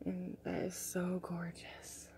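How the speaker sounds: soft, slow, awed whisper. Real, not acted. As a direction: breathe it out in wonder. Whispered admiration.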